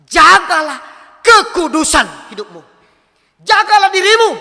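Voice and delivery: loud, high preaching command; forceful exhortation. Real, not acted. Preacher charging young people to stay holy, forceful command. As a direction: hammer each phrase out to the crowd.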